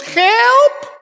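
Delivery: high, loud wailing cry; comic anguished wail; desperate cry for help. Shown as a demonstration. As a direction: cry out like a drowning man. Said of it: "le ha dicho en oración a Dios".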